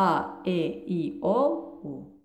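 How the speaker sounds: vowels ا ای او held long and slow; slow Spanish vowel drill; drawn-out vowels. Shown as a demonstration. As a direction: stretch each vowel ا ای او for students. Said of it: "پس حروف صدادار لوس وکالز".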